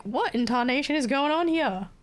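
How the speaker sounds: high-pitched, exaggerated bafflement; mock bewilderment. Acting out own bewildered reaction.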